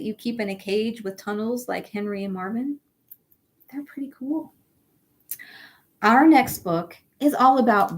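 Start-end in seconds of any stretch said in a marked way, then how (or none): 3.7-4.5 soft unvoiced aside; whispered aside. Real, not acted. Teacher whispers to the children that hamsters are cool. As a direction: share it like a little secret.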